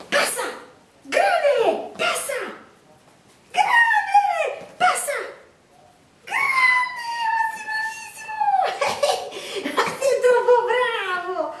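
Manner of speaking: high, excited praise for a dog; talking to a dog. Real, not acted. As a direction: cheer the dog on, squealing with delight. Cheering the dog's jumps.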